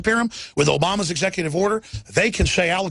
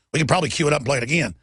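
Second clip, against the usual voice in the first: ordinary talk, nothing marked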